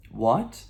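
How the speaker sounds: "what" rising as a question; questioning rise. Shown as a demonstration. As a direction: lift "what" upward, as if querying. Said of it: "that questionable".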